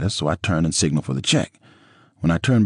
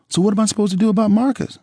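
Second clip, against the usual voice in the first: Nicole's voice; 4 dB louder, faster than usual. A narrator's reading.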